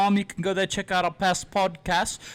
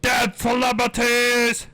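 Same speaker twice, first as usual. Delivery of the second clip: higher, slow segment shout; hammed-up title call; announcer call; 5 semitones higher, 3 dB louder, slower than usual. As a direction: draw it out like a hype announcer.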